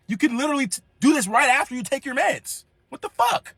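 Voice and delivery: high, exasperated scolding; mock yell at nana. Raised voice at grandma.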